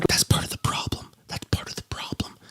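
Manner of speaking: very soft, voiceless whisper; whispered exasperation. Real, not acted. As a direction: whisper the objection under your breath. Muttered objection over the played clip.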